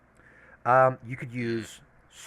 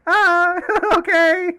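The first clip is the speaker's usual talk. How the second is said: laughing; 11 dB louder, slower than usual. Acted. Kids running off confused.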